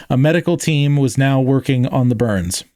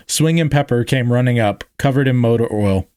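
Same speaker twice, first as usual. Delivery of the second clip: plain reading voice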